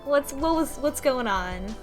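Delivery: usual speaking voice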